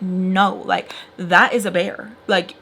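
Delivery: ordinary talk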